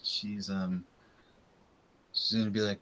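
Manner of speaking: ordinary delivery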